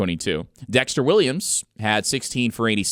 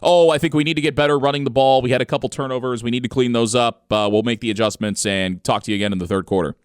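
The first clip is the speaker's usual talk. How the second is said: fast, louder coach-speak rattle; rote halftime-interview coach; 4 dB louder, faster than usual. Retold or imitated. Coach answering a halftime sideline reporter, rote and rushed. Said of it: "That's how ninety percent of those go".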